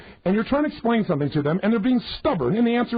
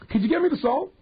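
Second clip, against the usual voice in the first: asking for salt; 8 semitones higher than usual. Retold or imitated. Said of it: "somebody say to you".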